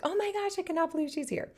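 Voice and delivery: high-pitched mock gasp of disbelief; imitating shocked friends. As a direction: play the friends squealing in shock. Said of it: "They were, like, shocked".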